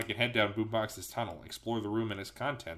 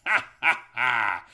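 villain laugh; 8 dB louder, slower than usual. Acted. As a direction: cackle like a mad scientist. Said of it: "Robotnik's fiendish voice, which is still laughing".

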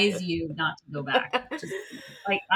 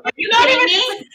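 much higher, loud disbelief; shocked; 14 semitones higher, 9 dB louder than usual. Real, not acted. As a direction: react in real outrage. Shocked at short school time.

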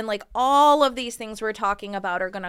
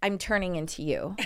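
ordinary talk, nothing marked

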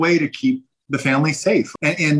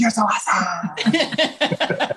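high, slow cheer; 8 semitones higher than usual. Retold or imitated. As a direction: cheer him on, lifted up. Cheering son in morning.

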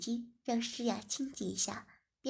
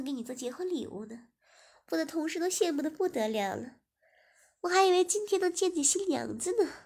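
louder, higher, building excitement; gossiping young woman; 4 semitones higher, 6 dB louder than usual. Acted. Guest Ziying gushing about the famous wedding, louder and higher. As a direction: gush more and more.

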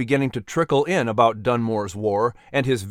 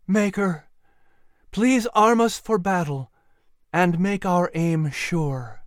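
earnest prayer; 5 semitones higher, slower than usual. Acted. Clary prays before battle.

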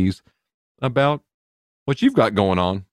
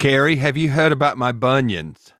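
a weak, hammed-up attempt at an Australian accent; imitating an Australian accent badly, a little higher; lousy Australian accent; 5 semitones higher than usual. Host mimics a friend asking about bunions, bad Australian accent. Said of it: "Australian accent".

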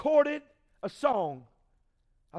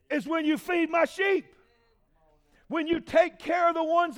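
preaching; 2 semitones higher, 4 dB louder than usual. Acted. Preacher voicing Jesus to the church, full force. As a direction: drive Jesus' words home from the pulpit.

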